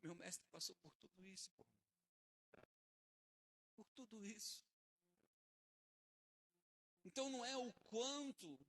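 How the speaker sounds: usual speaking voice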